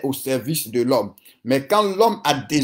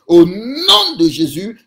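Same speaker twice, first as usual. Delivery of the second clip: ringing proclamation; 4 semitones higher, 6 dB louder, slower than usual. Real, not acted. Declaring over the congregation.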